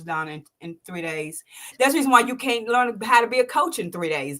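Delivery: ordinary talk, nothing marked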